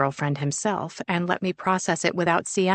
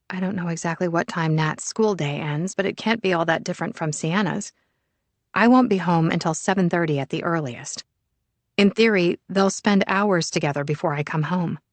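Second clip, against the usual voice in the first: ordinary delivery, nothing marked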